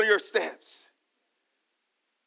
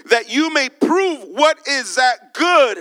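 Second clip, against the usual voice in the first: "good" shouted, slow and louder; shouted scripture; 4 semitones higher, 7 dB louder than usual. Acted. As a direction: proclaim the verse at full voice. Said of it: "And then he says here".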